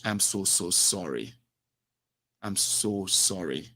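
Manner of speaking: calm the furious caller down gently and slowly; soothing apology. Retold or imitated. Agent apologising to angry caller.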